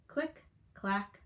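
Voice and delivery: lively storybook read-aloud; animated picture-book narration; reading to children. Acted. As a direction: tell it to a class of young kids.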